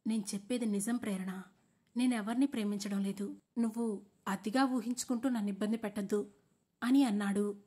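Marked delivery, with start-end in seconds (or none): none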